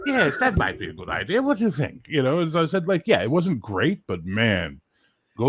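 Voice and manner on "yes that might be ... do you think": higher, genteel British colonizer voice. Retold or imitated. Teacher mocking British colonizers to students, higher, genteel voice. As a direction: play a fussy, self-satisfied British colonial officer. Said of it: "The British showed up and went".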